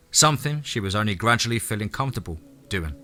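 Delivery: plain reading voice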